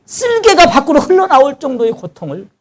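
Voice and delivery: high, forceful anguished sermon delivery; impassioned preaching. Acted. Preacher voicing Job's complaint against God, high and impassioned.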